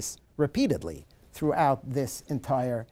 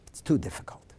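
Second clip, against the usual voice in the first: low, soft, fading to a whisper; defeated, lower and much softer; said sadly; 6 semitones lower, 6 dB quieter than usual. Retold or imitated. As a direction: let the hope drain out, near whisper. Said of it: "he said sadly".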